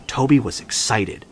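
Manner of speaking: ordinary delivery, nothing marked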